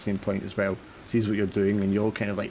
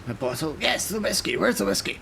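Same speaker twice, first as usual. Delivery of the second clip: higher captain voice, demanding; gruff captain; 7 semitones higher than usual. Acted. Captain questions the sailor, then wants his whiskey. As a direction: snap for the whiskey like an old captain.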